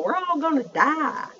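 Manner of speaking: high, slow imitated Southern drawl. Imitating a friend from work, exaggerated southern drawl. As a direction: draw it out like a Southern friend being dramatic. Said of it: "with her southern drawl".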